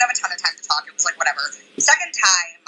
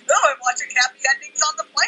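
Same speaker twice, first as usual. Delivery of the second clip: self-mocking airhead; 6 semitones higher than usual. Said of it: "dumb ass being like".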